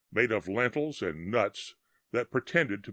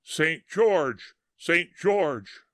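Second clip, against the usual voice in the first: higher and louder battle cry, GEORGE punched; shouting; 6 semitones higher, 4 dB louder, much slower than usual. A narrator's reading. Thousands of phantom soldiers calling their saint, shouting. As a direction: cry the saint's name like an army. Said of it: "thousands shouting".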